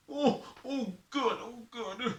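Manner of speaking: high, whimpering thanks; tearful relief. Acted. Sufferer hears medicine is coming, high tearful relief. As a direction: whimper with relief through the toothache. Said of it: "Don't cry".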